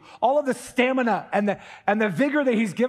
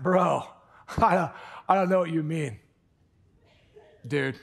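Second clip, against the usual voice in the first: put-on SoCal bro talk; strong SoCal accent; 3 semitones lower than usual. Preacher imitating Peter denying it, SoCal accent. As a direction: do a laid-back SoCal accent. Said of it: "busted out a SoCal accent".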